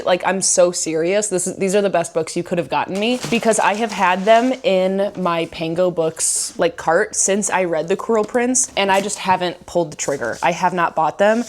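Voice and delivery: ordinary talk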